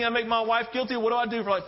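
ordinary delivery

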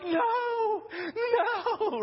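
high, wailing mock cry; distraught mother. Sarah running over, knowing what's going on, acted wail. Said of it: "and she's like".

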